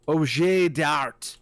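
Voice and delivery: put-on slow, careful French accent on Objet d'art. Narrator reading the room text, mock French accent. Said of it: "That's my best French accent".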